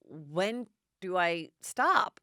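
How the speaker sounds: slow self-questioning inner voice; drawn out, slow, as if asking yourself; slow musing. Retold or imitated. Host imagines a person asking herself, slow and wondering. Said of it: "do you go".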